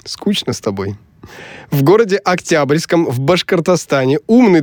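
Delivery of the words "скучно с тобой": low, soft whisper. Real, not acted. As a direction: whisper it with mock disdain. Whispered teasing reply.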